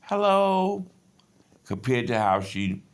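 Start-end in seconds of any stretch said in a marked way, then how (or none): 0.0-0.8 high, loud, prim telephone hello; put-on Americanized voice. Wife picking up the sergeant's call, in a put-on Americanized voice. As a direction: sweeten it into a polished hotel-desk greeting. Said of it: "she put on the Wellington Hotel, ... articulate".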